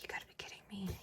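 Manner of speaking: whispered disbelief at the van; whispered talking-to aimed at a broken van door; exasperated whisper. Retold or imitated. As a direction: hiss it under your breath, fed up. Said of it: "And I'm like".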